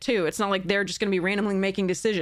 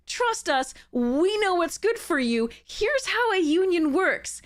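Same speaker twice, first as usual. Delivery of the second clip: a hint of a smug employer, pitched up, mocking; mock company voice; 9 semitones higher, slower than usual. Streamer mocks company reassuring workers in a mild smug voice. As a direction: lightly mimic the patronising bosses. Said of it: "the people who are like".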